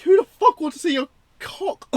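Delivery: loud, high disgusted outburst; outraged yell. Real, not acted. Reviewer yelling in disgust at the film's graphic scene, loud outraged yell. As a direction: yell it in real disbelief.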